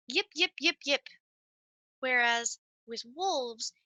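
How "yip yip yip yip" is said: short sharp yips, a bit louder; coyote yips. Shown as a demonstration. Showing kids the coyote's short burst calls, yipping. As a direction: bark out quick yips like a coyote. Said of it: "short burst calls".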